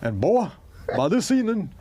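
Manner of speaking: fake Southern accent. Mocking a Hollywood actor's fake Southern accent, hammed-up. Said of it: "he's doing that accent that doesn't exist".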